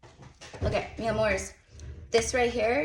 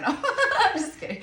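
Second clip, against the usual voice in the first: laughing; 14 semitones higher, 5 dB louder than usual. Real, not acted. Joking about being a cheater.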